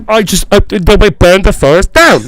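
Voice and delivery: loud, hammed-up English accent; mock actor's big line, loud; Michael Caine impression. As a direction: blare it out as a mumbling English actor. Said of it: "the line that you kept saying over and over was from Batman".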